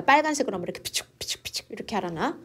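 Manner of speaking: quick whispered 피축피축피축 like a blinking light; whispered flashing noise. Shown as a demonstration. (깜빡이는 불빛 소리를 빠르게 속삭이며 흉내)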